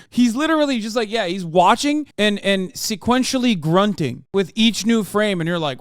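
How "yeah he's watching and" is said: ordinary talk, nothing marked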